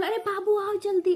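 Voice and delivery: high, loud urgent call to come quick; mother's frantic shout. Retold or imitated. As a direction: yell for Babu like mom in a panic. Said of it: "ऐसे चिल्लाई मेरी mummy". (ऊँची, तेज़ घबराई हुई पुकार)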